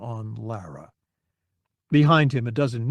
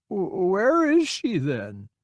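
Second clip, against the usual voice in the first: put-on lost old man; 6 semitones higher, slower than usual. A narrator's reading. Confused old man looks around.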